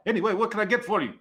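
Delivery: brisk high-pitched loud waitress voice; high, loud server. Acted. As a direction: call out for the order, high and fast. GM playing the server taking orders.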